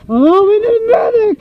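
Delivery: high call for help; calling for a medic. Pretend. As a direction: shout for the medic across the field.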